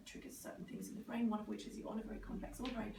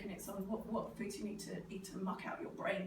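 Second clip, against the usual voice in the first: ordinary talk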